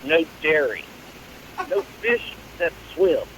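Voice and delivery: usual speaking voice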